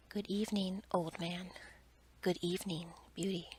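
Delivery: ordinary delivery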